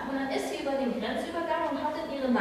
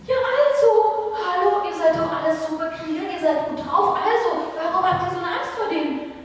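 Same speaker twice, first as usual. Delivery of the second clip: David scolding the frightened soldiers for their fear; exasperated; 6 semitones higher, 7 dB louder than usual. Acted. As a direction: shake them up in disbelief.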